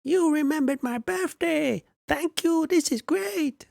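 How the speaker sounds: very high squeaky little dwarf; tiny dwarf thanking you for a birthday gift. Pretend. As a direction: pitch way up like a tiny fellow. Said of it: "how would a dwarf sound?".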